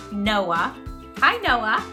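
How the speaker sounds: usual speaking voice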